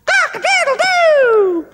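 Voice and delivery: very high, slow, loud crowing; cock-a-doodle-doo crowed up high; rooster crow. Retold or imitated. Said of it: "the rooster went".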